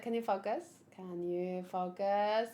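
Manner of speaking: usual speaking voice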